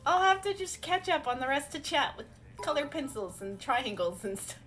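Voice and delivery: ordinary delivery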